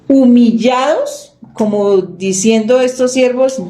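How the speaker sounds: ordinary talk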